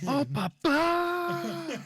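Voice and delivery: very high, loud, drawn-out wail; hammed-up reunion cry; mock overjoyed. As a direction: stretch it out high and melodramatic.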